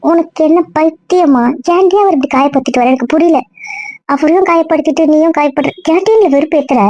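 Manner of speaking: high-pitched, frustrated reproach; exasperated scolding. Acted. Friend scolds her for hurting him and herself, exasperated.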